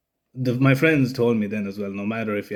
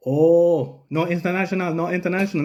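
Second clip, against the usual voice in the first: higher, repeated in imitated Thai-English; mock Thai cop; 5 semitones higher than usual. Cop inspecting the out-of-date license, imitated Thai accent. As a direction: mimic the officer shaking his head over it. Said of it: "and he's looking".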